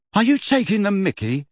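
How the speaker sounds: indignant schoolboy question; affronted. A narrator's reading. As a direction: ask as if being mocked. Ron frowning at her laughter.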